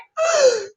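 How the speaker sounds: high-pitched gasp of disbelief; re-enacted sharp gasp; shocked gasp. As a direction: gasp as if stunned. Retold shock at seeing own story.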